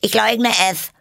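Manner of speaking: very high, loud denial; loud squeaky animal voice; indignant Hare. Acted. Hare denies it in court quarrel.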